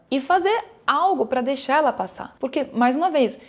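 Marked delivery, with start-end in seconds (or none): none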